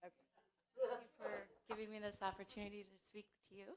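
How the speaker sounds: barely audible, hurried thanks; small, quick, very soft voice; too quiet. Real, not acted. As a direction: say it far too softly to carry. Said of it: "Speak up".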